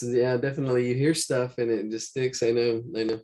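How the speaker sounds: ordinary talk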